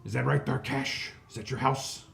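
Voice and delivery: loud, bossy crime-lord hobgoblin; greedy hobgoblin boss. Acted. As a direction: bark orders like a greedy fat boss. Hobgoblin bargains with party.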